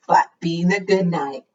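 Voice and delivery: ordinary delivery, nothing marked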